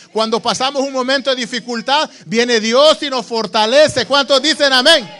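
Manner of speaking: preacher building from firm promise to loud climax; rousing preaching. Acted. Preacher promises God's strength to the congregation, rousing.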